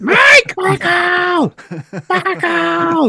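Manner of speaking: very high, drawn-out screech of a name; mock screaming mother. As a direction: imitate Mom screaming through the house. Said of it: "would scream my name".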